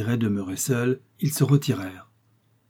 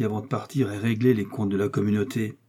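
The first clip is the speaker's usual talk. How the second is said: usual speaking voice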